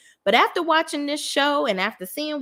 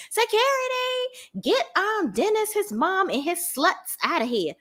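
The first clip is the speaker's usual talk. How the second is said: calling for security; 10 semitones higher than usual. Retold or imitated. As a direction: imitate an outraged woman summoning guards, without real volume. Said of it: "she's calling security".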